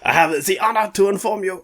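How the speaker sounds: put-on loud French accent that turns German; hammed-up German accent; accent gone German. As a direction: attempt French and land in German. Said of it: "That went German".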